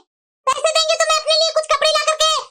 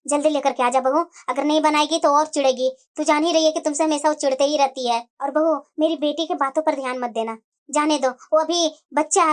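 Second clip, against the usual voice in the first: put-on elderly mother-in-law; 9 semitones lower than usual. Mother-in-law reassuring daughter-in-law. (बूढ़ी सास की धीमी, गहरी आवाज़)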